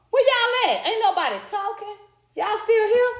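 high, playful call-out; calling out to the chat. Real, not acted. Vlogger calling to her silent viewers, high playful call.

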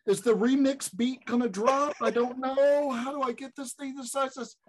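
high-pitched flustered retelling; mock panic. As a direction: fumble frantically, voice way up. Retold panic fumbling with phone.

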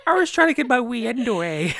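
badly faked Irish, on the edge of laughter; mock Irish accent. Host tops the joke for co-host, loud mock Irish accent. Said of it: "Our Irish listeners just switched off".

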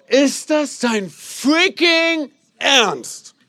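loud, high, drawn-out exasperated cry; frustrated outburst. Retold or imitated. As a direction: blow up at the torn bin bags. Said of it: "Und ich bin so". (empörter, gedehnter Aufschrei)

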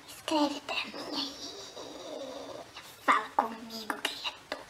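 whispered, close to the mic; whispering. Pretend. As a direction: whisper it like a secret. Intimate aside to viewer.

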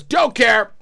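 curt, loud brush-off; dismissive snap. Retold or imitated. Cop's curt brush-off, quoted.

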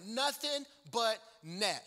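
ordinary talk, nothing marked

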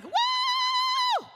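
huge high shout of praise; preacher shows the congregation how to shout praise; joyful woo. Shown as a demonstration. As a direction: let out a very high, exuberant woo. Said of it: "let us be like".

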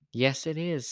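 ordinary delivery

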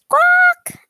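high, loud parrot squawk; cartoon bird screech.

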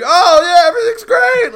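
very high, loud forced cheer; overly bouncy. Retold or imitated. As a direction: fake it way too bright. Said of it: "they kinda compensate, like".

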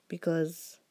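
ordinary talk

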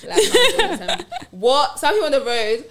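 loud, high exasperated outburst with laughter; shouting. Real, not acted. Venting to friends about other drivers, shouting. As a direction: shout your outrage at bad drivers. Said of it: "[name]'s shouting".

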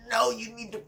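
high, drawn-out mock wail; mock scolding outcry. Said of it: "People are being like".

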